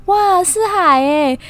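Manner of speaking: high, slow, drawn-out wow; mock excitement. Imagining mom seeing the sea from the train, mock excited. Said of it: "妈妈会是很兴奋".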